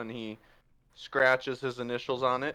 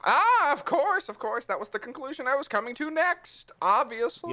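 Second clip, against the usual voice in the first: haughty Puss; 12 semitones higher than usual. Acted. Puss covering his mistake to Rivera, haughty and high. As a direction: pretend he knew all along. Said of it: "we need to use magic".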